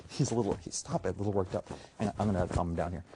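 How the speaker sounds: normal talking voice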